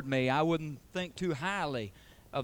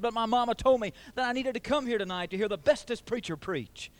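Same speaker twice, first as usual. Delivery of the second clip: higher-pitched, mildly pleading retold child; begging little boy; 7 semitones higher than usual. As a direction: lightly imitate a boy begging to be let in. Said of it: "he began to beg".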